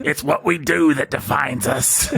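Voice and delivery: light, lilting princess voice; a hint of a cartoon princess; Princess Leia voice. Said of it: "give him a preview of your Princess Leia voice".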